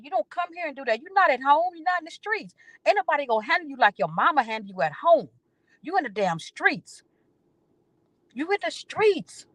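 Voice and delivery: high, pointed scolding; high scolding lecture. Retold or imitated. Telling the young coworker off, pitched high.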